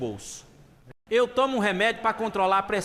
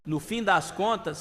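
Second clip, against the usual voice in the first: ordinary delivery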